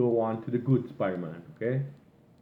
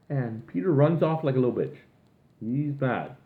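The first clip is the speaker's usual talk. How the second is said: ordinary delivery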